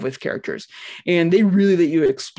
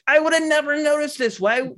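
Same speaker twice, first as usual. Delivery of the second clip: amazed; 10 semitones higher than usual. Retold or imitated. Amazed at bugs in game.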